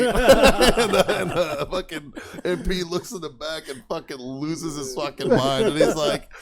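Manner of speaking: laughing through the words; can barely get it out for laughing. Real, not acted. Buddy telling friends the prank story, laughing. Retelling the MP losing it.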